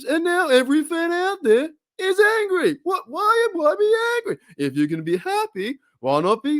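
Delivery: high-pitched comic exasperation; stand-up comedian's incredulous whine, breaking into a laugh; Jerry Seinfeld. Acted. Host showing off his impression to viewers, high and exasperated. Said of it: "trying to do my Jerry Seinfeld impression".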